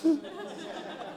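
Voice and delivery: risita aguda soplada; laughing. Real, not acted. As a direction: se ríe bajito del remate. Risa tras el remate. (risa suave sin voz)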